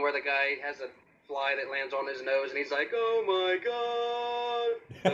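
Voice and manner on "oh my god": troll movie victim. Retold or imitated. Quoting troll movie character.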